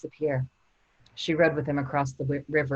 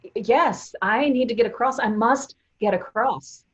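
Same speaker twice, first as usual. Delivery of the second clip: urgent, higher and louder pleading; desperate plea; 5 semitones higher, 6 dB louder than usual. Acted. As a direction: beg to be taken across.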